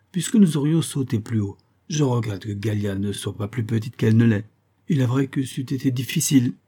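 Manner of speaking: plain reading voice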